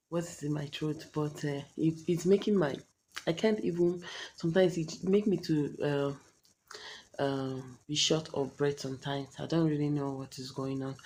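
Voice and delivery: normal talking voice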